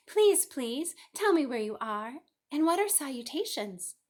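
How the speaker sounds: high, loud, desperate begging; a frantic piglet begging to know; pleading pig. A narrator's reading. Wilbur pleads.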